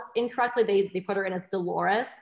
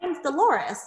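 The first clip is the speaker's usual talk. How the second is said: imitating the client; 7 semitones higher, slower than usual. Client correcting her name.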